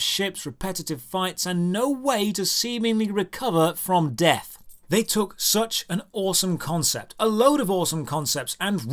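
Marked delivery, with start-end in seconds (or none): none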